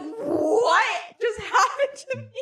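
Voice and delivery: mock bewildered. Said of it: "they were like".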